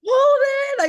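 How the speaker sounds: high, loud call for a son; re-enacted high-pitched yell; retold scream. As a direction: scream the name down the house. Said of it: "I screamed for my son".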